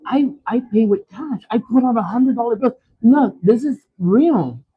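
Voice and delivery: high, pleading mock protest; pressing cash on a cashier who won't take it; retold frantic insistence; exasperated plea. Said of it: "I'm like".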